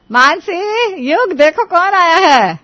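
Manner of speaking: loud, high, slow call across the house. Acted. Mother calls her son and daughter-in-law to greet guests, loud call. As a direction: call them to come see who arrived. Calling out to family.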